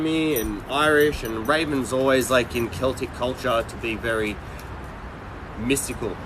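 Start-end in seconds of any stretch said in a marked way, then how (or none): none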